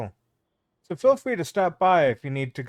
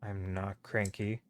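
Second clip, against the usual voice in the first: low, slow sulky grumble; soft, low, grumpy protest; cranky denial; 6 semitones lower, 10 dB quieter, slower than usual. Acted. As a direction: deny it quietly, sulking under the breath. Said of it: "He's just a little cranky".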